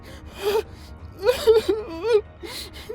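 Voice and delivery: crying gasp. Acted. Gasping as they fight.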